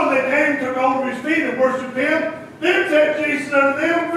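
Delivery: ordinary talk, nothing marked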